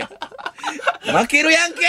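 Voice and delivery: high, laughing, stretched-out cry; laughing protest. Real, not acted. Host protesting the mock trial through real laughter.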